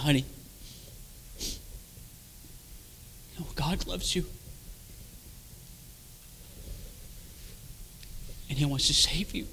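soft, tender, unhurried; gentle reassuring wife. Retold or imitated. Wife holding her husband's hand, softly reassuring. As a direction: soothe softly with long pauses. Wife comforting him, retold.

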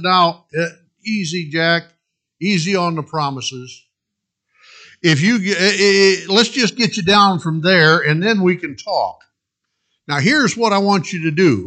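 soothing voice of the Lord, lightly played; calm reassurance. The Lord calming panicked Jack, gentle reassurance. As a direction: settle him down gently.

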